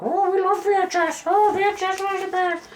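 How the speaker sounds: mocking people. Vlogger mocking VHS fans, high mocking voice. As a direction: mimic fans in a silly high voice. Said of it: "all the people were like".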